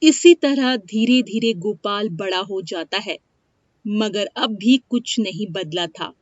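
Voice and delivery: usual speaking voice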